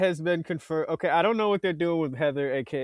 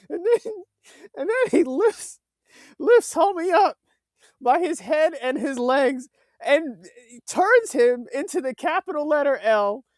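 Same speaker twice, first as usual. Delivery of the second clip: excited; 11 semitones higher, 8 dB louder than usual. Real, not acted. Retelling a brutal fight scene.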